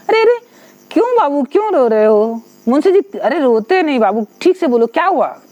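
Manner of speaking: high, tender father soothing his son; tender coaxing. Retold or imitated. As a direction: coax a crying child gently. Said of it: "बहुत दुलार प्यार से पूछे". (दुलार से बच्चे को चुप कराते पिता)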